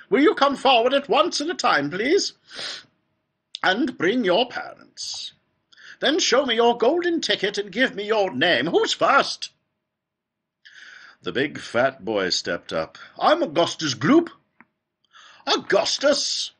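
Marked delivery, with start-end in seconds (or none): 0.1-9.5 high, loud, fluty host. A narrator's reading. Factory owner summoning children with tickets, high and fluty. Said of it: "His voice was high and fluty". 13.2-14.3 put-on high, loud boy's voice. A narrator's reading. Big boy stepping up to the factory owner, loud introduction. 15.5-16.5 very high, drawn-out delighted cry. A narrator's reading. Factory owner greeting the boy, high fluty cry. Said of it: "cried Mister Wonka".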